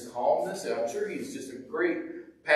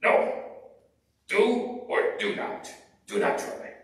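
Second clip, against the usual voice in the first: Yoda impression; 3 semitones higher than usual. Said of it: "And he's like".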